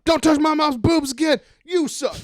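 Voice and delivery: high, loud indignant yell; mock childish quarrel. Cousin arguing over his mom, high loud mock outrage. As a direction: shout it as a squabbling cousin. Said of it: "Or were they like".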